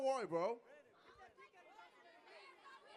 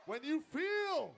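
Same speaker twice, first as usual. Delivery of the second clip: megalomaniac rant; 2 semitones lower, 13 dB quieter than usual. Acted. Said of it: "You sound like a supervillain".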